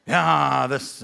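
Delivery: low grumbling moaner, trailing down; mock complainer. Imitating negative complainers.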